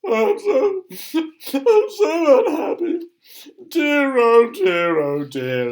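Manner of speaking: high, loud mock bawling; hammed-up sobs, sinking at the end; sobbing. A narrator's reading. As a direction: wail like a miserable storybook king. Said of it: "he keeps sobbing over and over again".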